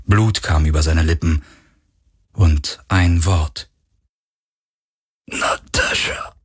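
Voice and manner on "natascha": breathy last word, barely voiced; dying whisper. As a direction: let the name slip out as he dies.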